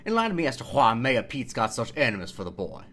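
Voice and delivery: put-on broad, drawled Deep South accent; Deep South tobacco CEO. Host reading the viral tweet aloud, broad Deep South accent. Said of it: "Deep South Tobacco C E O voice".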